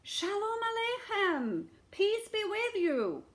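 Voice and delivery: high, slow beggar's call; beggar crying out. A narrator's reading. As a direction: call out a blessing, high and drawn out. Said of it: "the beggar looked up and cried".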